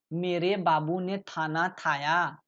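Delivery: lisping kh as th, slowly; cutesy lover's baby talk, slow. Shown as a demonstration. Teacher mimics lovers' baby talk for students. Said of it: "वर्तनी में परिवर्तन करके दिखाया गया है".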